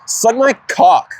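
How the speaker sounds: high, slow put-on drunk girl voice; mock drunk girl. Imitating his drunk sister, slow and high.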